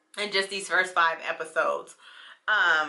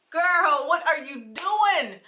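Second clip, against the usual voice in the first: incredulous; 8 semitones higher, slower than usual. Retold or imitated. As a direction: call out to her in disbelief. Reacting to show, I'm like.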